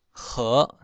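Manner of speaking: second 和 as "hô", rounded o, rising; Hokkien reading. Shown as a demonstration. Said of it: "和念成和".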